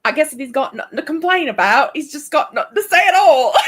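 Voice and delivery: loud, high hammed-up Cockney; Cockney accent. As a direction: shout it in a mock Cockney, cracking up. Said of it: "Now do it in a Cockney accent".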